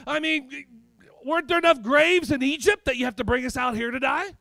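high, loud, relentless sermon delivery; full-force preaching. Real, not acted. Preacher challenging the congregation about Egypt, full-force preaching. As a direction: drive the questions home at a shout.

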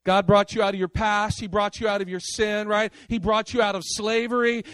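ordinary talk, nothing marked

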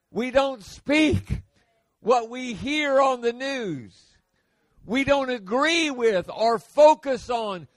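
preacher driving home the point; full-force preaching. Acted. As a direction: thunder it from the pulpit.

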